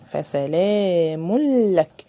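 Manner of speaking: فسلام لك drawn out, tanween merged into the lam; slow recitation. Shown as a demonstration. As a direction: chant فسلام لك slowly, no ghunna. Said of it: "ما اديناش تنوين".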